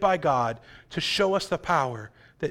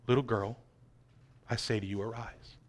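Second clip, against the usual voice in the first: quiet; 7 semitones lower, 11 dB quieter than usual. Acted. Jesus raising the girl.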